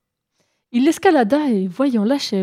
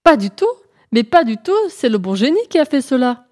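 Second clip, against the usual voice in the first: louder, higher indignant denial; protesting; 4 semitones higher, 5 dB louder than usual. Acted. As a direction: deny it hotly. Lièvre proteste vivement. (le lièvre nie avec force, plus fort et plus aigu)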